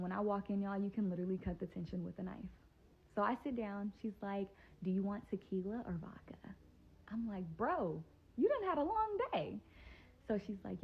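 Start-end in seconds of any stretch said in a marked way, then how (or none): 4.8-6.6 hushed, breathy-free whisper of a nervous offer. Retold or imitated. Friend offering drinks, tense room. 7.6-9.6 much higher and slower, teasing disbelief. Retold or imitated. Teasing a friend who suddenly wants to drink, high and slow.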